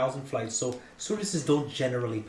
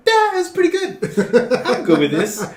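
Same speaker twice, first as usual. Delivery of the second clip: laughing acceptance; 12 semitones higher, 11 dB louder, slower than usual. Retold or imitated. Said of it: "I'm like".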